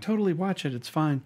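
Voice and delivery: imitated mom, breezily permissive; easygoing mom. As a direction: wave it off in a lifted mom voice. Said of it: "my mom would have been like".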